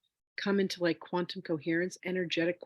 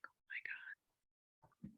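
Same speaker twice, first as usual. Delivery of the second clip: reenacted hushed, voiceless whisper; awed whisper; 21 dB quieter, much slower than usual. Telling how she met her twelve-million-dollar self, awed whisper. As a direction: whisper it very softly and slowly, as if stunned.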